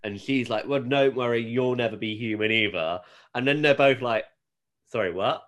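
normal talking voice

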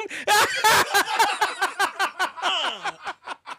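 long, high-pitched burst of laughter; big laugh. Real, not acted. Host cracking up at friend's crude joke, long laugh. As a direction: crack up helplessly.

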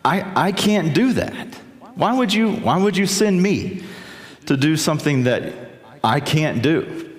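high anxious objection to God; Moses protesting. Acted. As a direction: protest like a man who can't believe he's chosen. Moses doubting God's call.

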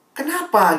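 high, astonished retold question; incredulous question. Asking the staffer why he has no group, incredulous. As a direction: ask why in acted disbelief.

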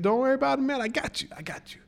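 mock an overconfident helper, trailing away; breezy reassurance. Voices Jack's breezy reassurance.

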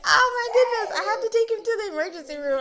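high, loud mock panic; retold freaking out. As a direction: blurt it out fast in hammed-up alarm. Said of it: "freaking out and just being like".

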